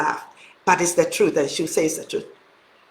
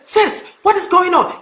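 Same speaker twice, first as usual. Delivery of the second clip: higher, urgent caring friend; a worried sister calling in a raised voice; concerned friend calling; 7 semitones higher than usual. Retold or imitated. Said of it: "Comfort called me right up and said".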